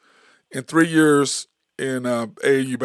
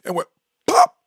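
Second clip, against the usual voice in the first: paper pop sound; 9 semitones higher, 4 dB louder than usual. Retold or imitated. Imitating the paper snapping.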